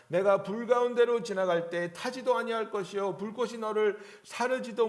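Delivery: loud, ringing Bible reading; scripture reading. Acted. Preacher reading Isaiah aloud to church, proclaiming. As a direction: proclaim God's words to the congregation.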